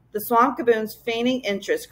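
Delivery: usual speaking voice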